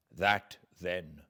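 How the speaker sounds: plain reading voice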